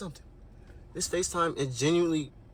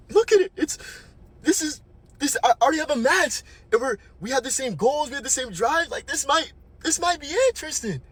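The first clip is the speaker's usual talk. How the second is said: much higher, gushing, louder at the start; giddy excitement; 10 semitones higher, 3 dB louder than usual. Retold or imitated. Mimicking Lexi as she gushes about her match, high giddy voice. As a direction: imitate a friend gushing over her new match.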